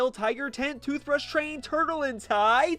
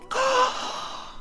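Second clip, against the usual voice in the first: excited gasp; 12 semitones higher than usual. Acted. Dog gets two VHS tapes.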